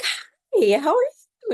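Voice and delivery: put-on high, sing-song hello to a toddler; bright high greeting for a two-year-old; sweet baby-talk greeting. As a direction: coo at a little child.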